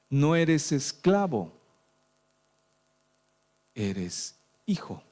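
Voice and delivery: slow, weighty stress on hijo; slow emphasis. Acted. Pastor telling believers they are sons, slow and weighty. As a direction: let each word land slowly.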